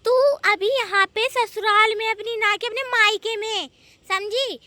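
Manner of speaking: stern scolding. Acted. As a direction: lay down the law angrily. Mother-in-law lays down house rules. (सख़्ती से ऊँची आवाज़ में डाँट)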